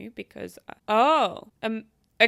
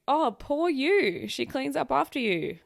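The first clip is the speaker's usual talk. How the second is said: drawn-out, higher mock pity; mock sympathy; 4 semitones higher than usual. Real, not acted. Reader teases a husband complaining his wife cleans up, mock sympathy.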